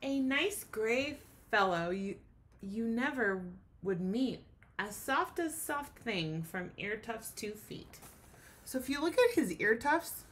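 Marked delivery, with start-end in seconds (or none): 0.0-8.0 gentle storybook reading; reading to children. A narrator's reading. Teacher reading the class a rhyming story. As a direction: read it like a bedtime picture book. Teacher reads picture book aloud.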